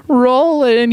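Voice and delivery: calling out name. Pretend. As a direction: call out to the company like a friend. Calling out to Roland.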